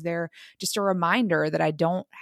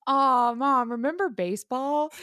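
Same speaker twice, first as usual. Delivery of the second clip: put-on higher-pitched kid reminiscing to mom; child's wistful plea; 6 semitones higher, slower than usual. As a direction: mimic a child's nostalgic sigh to a parent. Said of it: "He goes".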